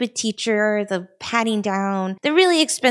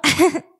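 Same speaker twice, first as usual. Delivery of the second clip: brief higher-pitched chuckle; real uneasy chuckle; awkward laugh; 4 semitones higher than usual. Real, not acted. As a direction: break off into a short laugh.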